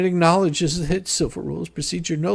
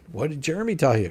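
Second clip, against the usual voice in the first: ordinary talk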